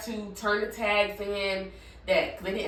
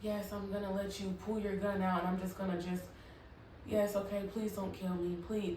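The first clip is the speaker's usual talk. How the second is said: much softer, mocking a terrified plea; mock fearful pleading; 3 semitones lower, 12 dB quieter than usual. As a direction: beg meekly, as a scared person would. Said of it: "so afraid and in fear".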